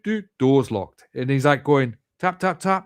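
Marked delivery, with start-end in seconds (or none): 2.2-2.8 tap 1. Retold or imitated. Imitating knocking on door.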